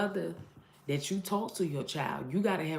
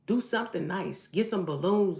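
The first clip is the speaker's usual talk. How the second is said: normal talking voice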